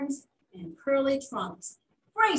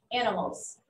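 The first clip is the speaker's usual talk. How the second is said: ordinary delivery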